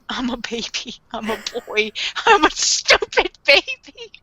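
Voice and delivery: hammed-up pathetic crybaby; whimpering baby act. Mocking Light as pathetic baby.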